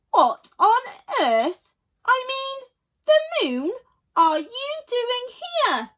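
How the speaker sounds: high, loud outraged splutter; the rich hippo confronts Sheila, scandalised; indignant surprise. A narrator's reading.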